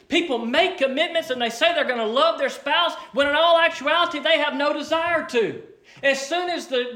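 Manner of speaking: loud, emphatic sermon; fiery preaching. Acted. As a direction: drive it home from the pulpit. Preaches at full force.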